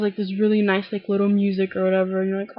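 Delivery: ordinary talk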